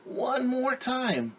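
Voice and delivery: very high, slow, drawn-out plea; pleading child. Acted. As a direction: stretch it out like a begging kid. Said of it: "And they're saying".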